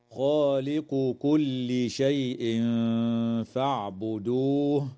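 slow chanted recitation, the tanween in شيء فاعبدوه hidden with a nasal hum; ikhfa with nasalization. Shown as a demonstration. Teacher demonstrating ikhfa to students, slow nasalized recitation. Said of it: "إخفاء حقيقي للتنوين عند الفاء".

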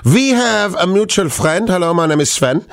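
put-on reading a listener's message in a light German accent; lightly put-on German accent. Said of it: "I'm allowed to do the German accent".

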